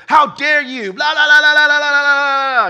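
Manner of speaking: high, loud indignant blah-blah rant; hammed-up worldly comeback, shouted; outraged squawk, played for laughs; mock angry outburst. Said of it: "come back at him like the world would".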